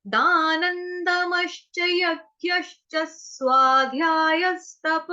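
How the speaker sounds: slow sacred chant of the verses; Sanskrit recitation. Acted. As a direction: intone each verse slowly.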